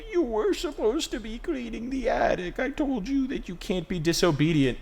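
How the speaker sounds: shrill, high-pitched nagging parent; high scolding dad voice. Retold or imitated. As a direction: scold like a squeaky cartoon dad. Dad scolding his kid.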